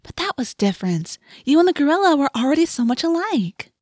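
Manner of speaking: high, lilting innocent teasing; mock sweetness. A narrator's reading. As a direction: deliver a jab coyly. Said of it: "She batted her eyes".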